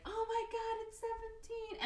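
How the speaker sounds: high-pitched mock squeal of delight; retold gushing. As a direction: gush in a high imitating voice. Retold gushing greeting, acting weird.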